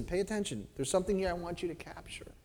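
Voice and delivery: quick, whispery appeal; soft, confidential call for attention; hushed urging. Acted. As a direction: drop your voice to pull them in. Acts hushed call to listen.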